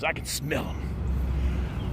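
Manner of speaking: a slow, hushed threat on the prowl; menacing whisper. Acted. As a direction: whisper it slowly, like a hunter closing in. Hunting the vagrant, hushed.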